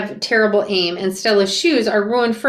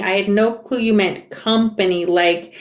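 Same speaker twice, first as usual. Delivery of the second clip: plain reading voice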